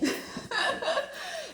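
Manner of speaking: a high, free burst of laughter at herself; bright laugh. Real, not acted. Laughing at herself for showing up without makeup. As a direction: laugh it off, a little embarrassed.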